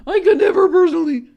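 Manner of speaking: high, loud put-on voice; exaggerated mocking squeal of a repeat. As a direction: parrot it back in a loud, high-pitched voice. Mockingly repeats own statement.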